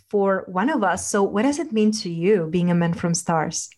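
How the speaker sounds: ordinary delivery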